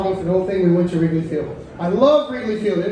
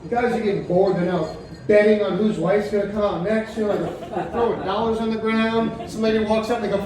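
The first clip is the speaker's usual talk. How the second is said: ordinary delivery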